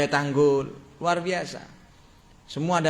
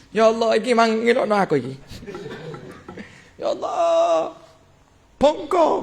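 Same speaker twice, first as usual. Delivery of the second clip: high, whining mock complaint to Allah, with a laugh; mock whiny prayer; 5 semitones higher, 3 dB louder than usual. Preacher mocks a grudge-holder praying for revenge, high whiny mock lament. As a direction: ham up a self-pitying plea.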